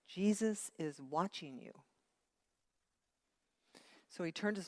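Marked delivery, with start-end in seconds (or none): none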